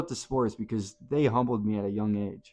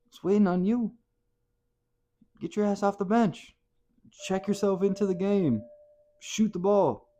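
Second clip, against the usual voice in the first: higher, pushing every order; fired-up urging; 8 semitones higher than usual. Acted. Coach ordering a hesitant player into the game, fired up. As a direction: shove him off the bench with your voice.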